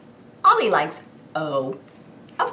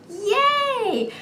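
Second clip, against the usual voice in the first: squealed yay. Acted. Cheering about the zoo.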